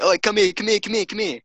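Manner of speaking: high, quick repeated calls, starting loud; playful calling out. Retold or imitated. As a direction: chase them with excited, high-pitched calls. Calling after fleeing friends while chasing.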